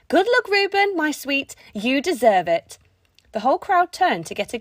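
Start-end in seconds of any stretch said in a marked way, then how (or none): 0.1-2.8 loud, high doting call across a field; shouted. Acted. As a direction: call out to her son, proud and loud. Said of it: "one lone voice shouted".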